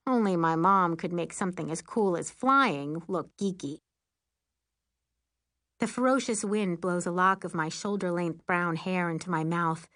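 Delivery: ordinary delivery, nothing marked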